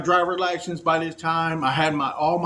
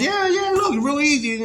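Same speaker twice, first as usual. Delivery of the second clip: much higher, brightly coaxing lady voice; cheerful checkout lady; 10 semitones higher, 3 dB louder than usual. Retold or imitated. Checkout lady coaxing the confused ex-con, high and chirpy. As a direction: chirp it like a helpful clerk. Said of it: "She goes".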